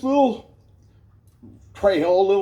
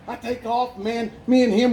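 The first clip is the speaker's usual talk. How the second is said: normal talking voice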